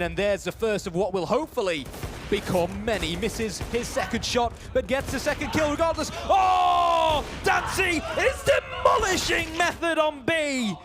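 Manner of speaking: high, loud shouted esports call, building to a roar then easing; excited play-by-play. Real, not acted. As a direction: erupt at the big play, stretching out the key words. Caster calls a multi-kill climax.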